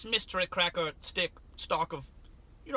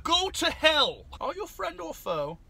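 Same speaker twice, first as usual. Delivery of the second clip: slow high mock threat; 10 semitones higher, 6 dB louder, slower than usual. Talking to pickle candy.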